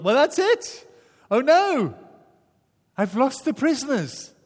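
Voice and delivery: high, loud panic; panicked jailer. Retold or imitated. Jailer panics, doors open.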